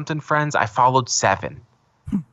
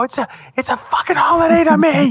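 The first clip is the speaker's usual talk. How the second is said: high, louder whiny insistence; indignant; 4 dB louder than usual. Retold or imitated. Insisting to his friend it's a holiday, high and loud. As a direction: insist it matters to you, pitched way up. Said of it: "And I go".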